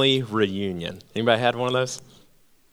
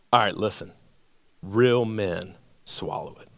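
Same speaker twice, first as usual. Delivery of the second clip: low tough uncle; 7 semitones lower, 7 dB quieter than usual. Retold or imitated. Uncle's punchline order.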